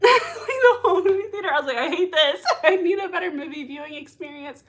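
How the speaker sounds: high, loud, laughing grumble; laughing complaint. Real, not acted.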